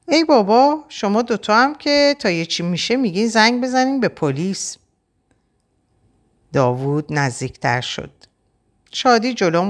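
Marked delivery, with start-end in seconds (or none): 0.1-4.8 high, mocking brush-off; sarcastic scoff. A narrator's reading. Davood sneers at the sisters' threat of police, mocking. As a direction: wave off the threat with scorn.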